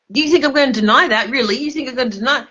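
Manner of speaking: heated disbelief; indignant retort. Real, not acted. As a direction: fire it back at the doubter. Angry retort to doubter.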